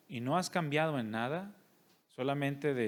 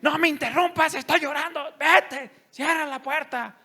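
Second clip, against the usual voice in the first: put-on much higher, much louder screaming at the kid; furious yell; 12 semitones higher, 10 dB louder than usual. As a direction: mom snapping at her son mid-prayer, yelling. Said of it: "y la avienta con el zapato". (grito furioso, mucho más agudo y fuerte)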